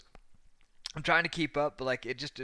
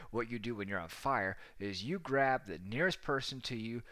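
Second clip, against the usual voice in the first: ordinary talk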